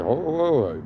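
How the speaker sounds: high, loud startled whoa, whoa. Retold or imitated. To a woman yanking the car window, startled and loud. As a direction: back off a grabbing stranger, startled. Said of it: "So I was just like".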